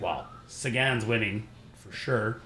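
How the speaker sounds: low, soft, slow aside to himself; quiet awe. Retold or imitated. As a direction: murmur it as a private concession. His own resigned thought, retold.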